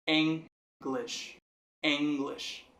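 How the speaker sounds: "English" split up, strong on "En"; stress on first syllable. Shown as a demonstration. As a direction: stress the "En" of "English", let the rest drop. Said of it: "N sendo a forte". ("English" com a primeira sílaba "En" forte)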